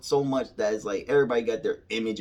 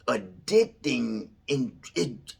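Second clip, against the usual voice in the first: ordinary delivery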